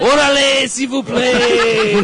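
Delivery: loud, high Mexican-French mash-up, last words stretched; mock Mexican-French accent. Teasing grandma's supposed French accent, hammed-up Mexican-French accent. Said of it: "That was a Guadalajara accent".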